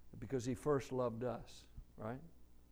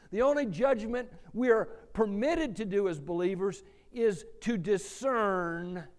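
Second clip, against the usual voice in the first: louder and higher, hammering each line; full-force preaching; 5 semitones higher, 10 dB louder than usual. Acted. Pastor driving the congregation to stop judging themselves, preaching hard. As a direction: preach it out with rising fire.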